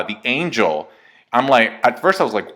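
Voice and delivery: ordinary delivery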